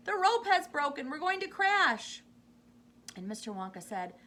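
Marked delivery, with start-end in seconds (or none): 0.1-2.2 panicked cry. A narrator's reading. Missus T V sure the lift will crash, panicked cry. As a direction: cry out in terror. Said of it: "Missus T V cried out".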